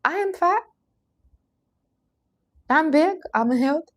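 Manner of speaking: high, bold self-declaration; mirror affirmation. Shown as a demonstration. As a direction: say it proudly to the mirror. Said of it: "look yourself in the mirror and say".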